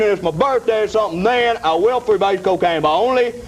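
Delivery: ordinary talk, nothing marked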